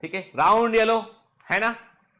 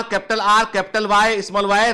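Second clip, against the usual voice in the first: ordinary talk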